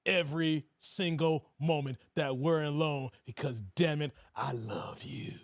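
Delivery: mock leering low voice; deep predatory voice. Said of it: "he looked like he's get-, it's like".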